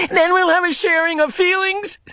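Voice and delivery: high, mocking, nasty tone; boy sneering at the giver; nasty sarcasm. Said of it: "the nasty, sarcastic voice again".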